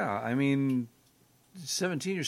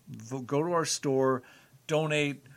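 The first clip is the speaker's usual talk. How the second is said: ordinary talk, nothing marked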